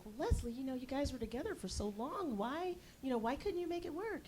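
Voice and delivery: high, soft pleading voice; concerned relatives. Retold or imitated. Relatives hearing of the breakup, high soft pleading. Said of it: "my relatives ... were just like".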